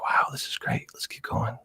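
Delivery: soft unvoiced whisper of glee; hushed delight. ASMR creator recalling her first subscribers, whispering. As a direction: whisper your excitement. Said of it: "I was like".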